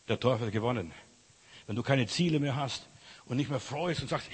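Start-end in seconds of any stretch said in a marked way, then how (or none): none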